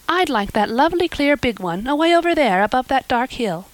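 put-on a girl thanking her new benefactor, eager; eager young Anne. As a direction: gush with hope like an imaginative girl. Anne voiced in story.

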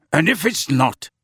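understated grim menace. A narrator's reading. Sirius promises backing for Harry, grim. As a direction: leave a threat hanging, pitched slightly up. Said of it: "said Sirius grimly".

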